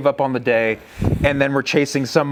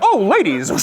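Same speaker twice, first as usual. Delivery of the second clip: playful, higher voice for an elk; lusty bull elk; 8 semitones higher, 4 dB louder than usual. Retold or imitated. Bull elk noticing the fleeing cows, playful high aside. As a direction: lightly voice a bull delighted by cows. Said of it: "He's like".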